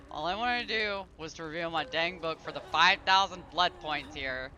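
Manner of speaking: usual speaking voice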